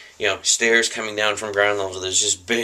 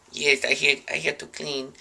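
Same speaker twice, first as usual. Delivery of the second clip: higher, hammed-up broken English, fading quieter; broken-English cleaner; 5 semitones higher, 5 dB quieter than usual. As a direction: play a caught intruder faking a foreign cleaner. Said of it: "I'm like".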